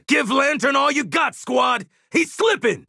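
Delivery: very high-pitched rallying yell; urgent battle shout. Acted. Squad leader urging attack on Lantern, urgent shout.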